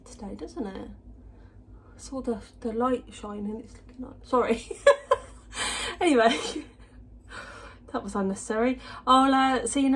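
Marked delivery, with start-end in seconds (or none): 4.7-5.2 short high burst of laughter; a sudden giggle breaking out; quick high giggle. Real, not acted. Laughs at own remark.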